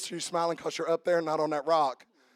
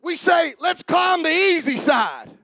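loud, high fiery preaching; 9 semitones higher, 10 dB louder than usual. Acted. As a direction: preach it with full force. Preaching at full force.

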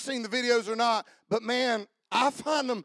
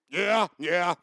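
deep voice; 5 dB louder than usual. Retold or imitated. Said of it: "he's got this deep voice".

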